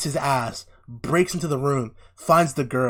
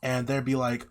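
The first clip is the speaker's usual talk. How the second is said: ordinary talk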